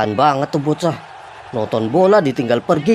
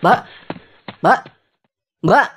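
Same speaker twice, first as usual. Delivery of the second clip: calling after someone walking away, louder each time; 6 semitones higher, 3 dB louder than usual. Acted. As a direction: call her back, urgent. Calling after the leaving waitress. (memanggil dengan keras)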